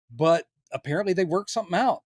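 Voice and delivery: ordinary talk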